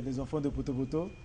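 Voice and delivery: ordinary talk, nothing marked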